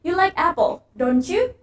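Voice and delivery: don't you rising at the end; asking for agreement, voice climbs on you; rising tag. Shown as a demonstration. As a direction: lift you up like a real question. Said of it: "bakal naik di akhir".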